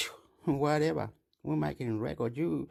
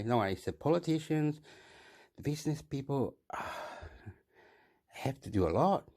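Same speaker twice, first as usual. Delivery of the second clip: normal talking voice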